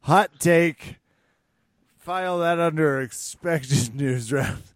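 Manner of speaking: loud, high mock headline; yelled. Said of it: "I should have yelled it".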